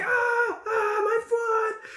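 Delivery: high, drawn-out acted scream; screaming in pain. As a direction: wail over a severed foot. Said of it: "he's just screaming now on the floor".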